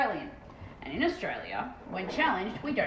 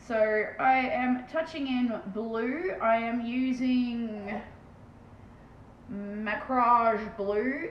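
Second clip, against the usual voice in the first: slow careful naming; much slower than usual. Real, not acted. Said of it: "If my pronunciation doesn't do it for you".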